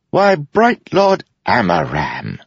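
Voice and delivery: very high, slow mocking greeting; a jester's shrill welcome, drawn out slow; Wit crying out. A narrator's reading. Jester greets the general with a very high, slow mock welcome. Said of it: "Wit cried".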